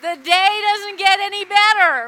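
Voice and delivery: loud, high burst of delight; joyful outburst. Real, not acted. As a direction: shout it with overflowing joy. Overjoyed about the boy's salvation.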